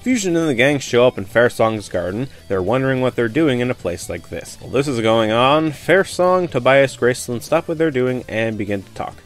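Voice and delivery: usual speaking voice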